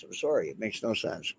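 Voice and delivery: usual speaking voice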